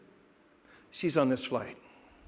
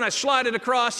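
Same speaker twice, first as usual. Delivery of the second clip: ordinary talk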